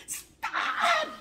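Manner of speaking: very high, loud shout; piercingly high yell of protest; retold pained scream. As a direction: re-enact screaming out in pain. Re-enacted cry of pain.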